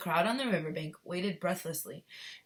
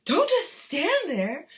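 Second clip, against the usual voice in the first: slow, high, loud scream; 11 semitones higher, 10 dB louder, much slower than usual. Acted. Wife screaming at husband, slow and loud. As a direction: berate him. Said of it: "Missus Gloop screamed".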